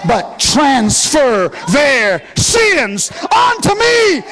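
high, loud intoned preaching climax; shouted high sermon whoop; preaching at full force. Real, not acted. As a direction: whoop it out to the congregation.

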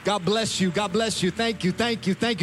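high, loud thanks thrown out over clapping; rousing, repeated shouts of gratitude; calling over applause. Real, not acted. Urging applause over a clapping crowd.

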